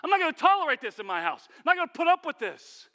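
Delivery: high, loud, fed-up declaration; exasperated outburst. Acted. Martha refusing to tolerate her sister, loud exasperated outburst. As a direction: lay down the law, fed up.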